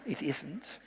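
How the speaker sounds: soft, slightly lifted aside; very quiet. Real, not acted. Speaker repeats a quiet correction about social media to the audience, very quiet. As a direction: repeat it gently, keeping the volume down. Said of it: "Can I say it very quietly?".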